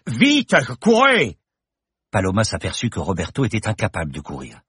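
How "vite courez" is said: slow, much higher, louder yell; shout. Acted. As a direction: drive them to run. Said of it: "Bergton hurla à nouveau". (cri, lent et perçant)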